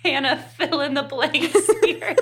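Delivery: giggly, high-pitched admission; laughing confession. Real, not acted. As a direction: confess it while cracking up. Confesses forgotten notes while laughing.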